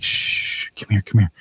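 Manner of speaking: a whispered shh, then soft calling; hushed shush. Retold or imitated. Husband quietly hushing his wife toward the window, hushed. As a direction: hush her over quietly, not to draw it.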